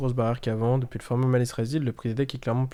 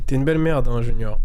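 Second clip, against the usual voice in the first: loud playful jab at a viewer; loud teasing insult; 7 dB louder than usual. Real, not acted. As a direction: bark it out in mock outrage. Teasing a chat viewer loudly.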